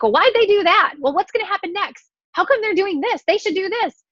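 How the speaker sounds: high, nosy backseat critic voice; nagging roommate. Retold or imitated. Roommate nagging at the TV in a high voice. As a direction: mimic a know-it-all roommate. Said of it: "they're like".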